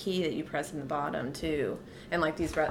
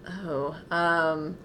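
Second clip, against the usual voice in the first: ordinary talk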